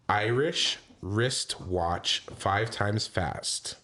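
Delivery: slowly spelled out, word by word; slow demo. Shown as a demonstration. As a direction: say it slowly, carefully, as a model. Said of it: "take a turn saying".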